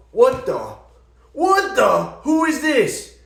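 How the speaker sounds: rising in pitch with each baffled question; shocked disbelief. Retold or imitated. Hearing his girlfriend sound unlike herself, stunned and loud. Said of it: "and I'm like".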